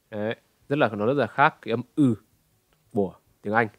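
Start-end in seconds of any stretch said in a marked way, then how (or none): none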